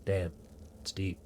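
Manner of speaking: usual speaking voice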